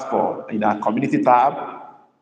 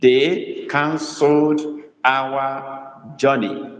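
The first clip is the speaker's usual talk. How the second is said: slow dictation; much slower than usual. Shown as a demonstration. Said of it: "Emphasis is on canceled".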